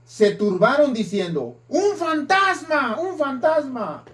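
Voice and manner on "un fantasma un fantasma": high, startled cry of fear; frightened cry. A narrator's reading. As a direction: cry out as if seeing a ghost. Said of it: "dieron voces de miedo".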